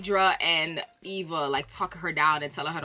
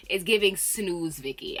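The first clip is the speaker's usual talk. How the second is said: ordinary talk, nothing marked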